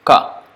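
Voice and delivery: ka said from the soft palate, tongue back, no voice; unvoiced velar ka. Shown as a demonstration. Teacher showing students an unvoiced ka. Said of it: "touching the soft palate".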